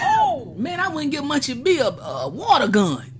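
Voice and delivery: very high, loud cry of disbelief; incredulous outburst. Real, not acted. Streamer balking at arming Munchie, incredulous. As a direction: cry out high, then come down bit by bit. Carries on outburst about the knife.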